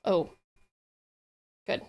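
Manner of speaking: ordinary talk, nothing marked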